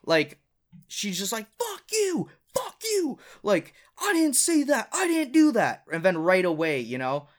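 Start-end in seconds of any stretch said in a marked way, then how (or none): 1.6-3.2 very high mock scream; imitated screaming. Girl cursing him as he apologizes, imitated screaming. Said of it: "right when she started screaming". 4.0-5.8 high, loud mock shriek; imitated screaming. Narrator mimicking the argument's shouted denials, imitated screaming. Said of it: "right when she started screaming".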